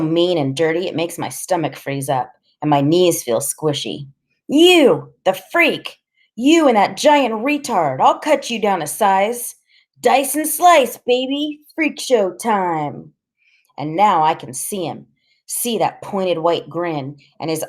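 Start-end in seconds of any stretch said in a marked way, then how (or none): 4.5-13.1 loud, high taunting threats; bully sneering and threatening; menacing threat. Acted. Blade threatening the boys.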